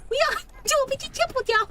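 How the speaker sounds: high, fast, mocking parrot voice; mocking imitation. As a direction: mimic her in a silly squeak. Mocking partner's line.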